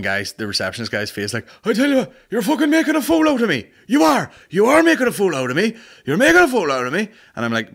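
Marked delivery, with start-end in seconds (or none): 1.6-7.1 shouting traveller. Retold or imitated.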